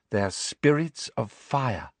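slow, odd dreamy voice; Luna, dreamy. A narrator's reading. Luna to the group, slow and dreamy. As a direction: drift through it slowly.

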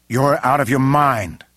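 loud, high, drawn-out disbelief; incredulous outburst. A narrator's reading.

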